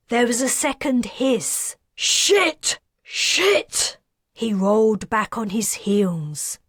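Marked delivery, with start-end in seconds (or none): 1.9-4.0 much higher, horrified swearing; shocked cursing. A narrator's reading. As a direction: curse in sheer horror. Kez after the man's suicide.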